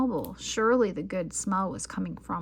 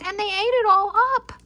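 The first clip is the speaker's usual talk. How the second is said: much higher, louder squeak; high squeaky voice; 12 semitones higher, 9 dB louder than usual. A narrator's reading. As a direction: sputter it as little baby bear. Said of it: "in her high, squeaky voice".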